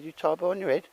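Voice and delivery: put-on high but level retelling of the boy's line; re-quoting the boy's high question; repeating the kid's question. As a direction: quote the boy again, high. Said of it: "He said".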